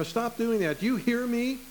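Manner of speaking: a hint of a stern parent scolding a child; mock-stern warning to unruly grandkids. Grandparent scolding rambunctious kids, mildly stern. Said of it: "you would say to them something like".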